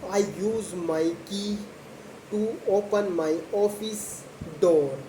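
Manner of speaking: normal talking voice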